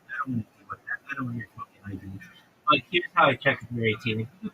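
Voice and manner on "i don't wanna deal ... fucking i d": hushed, muttered complaint; soft, fast whispered gripe; whispered aside. Real, not acted. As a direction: grumble it under your breath.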